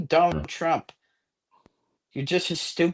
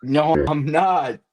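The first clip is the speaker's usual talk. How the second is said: slow, slurred mocking echo; mock drunk slur; slower than usual. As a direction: imitate a drunk denying it. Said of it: "You're slurring your words".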